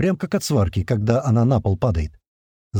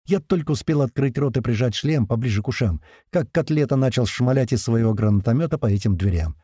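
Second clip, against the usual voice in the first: ordinary delivery, nothing marked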